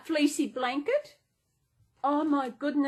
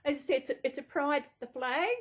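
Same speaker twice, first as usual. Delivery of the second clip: ordinary talk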